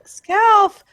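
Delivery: high loud cry of alarm; anguished cry. Acted. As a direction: shout her name in high alarm. Character sees wounded companion.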